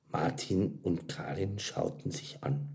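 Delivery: ordinary delivery, nothing marked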